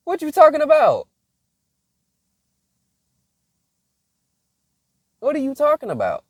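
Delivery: high, loud disbelieving squeal; incredulous. Retold or imitated. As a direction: shriek it in mock disbelief. Incredulous reply to cousin.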